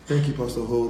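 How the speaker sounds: Pastor Ho, with an h before the O; says Ho for O. Retold or imitated. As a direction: Binta thanking the pastor, saying Ho. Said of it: "she calls me Pastor Ho".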